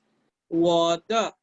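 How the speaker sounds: 我的 said slowly, each syllable clear; slow model word. Shown as a demonstration. Teacher modeling 我的 for Spanish-speaking students, slow. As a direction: give 我的 slowly so they can copy. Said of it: "si yo digo".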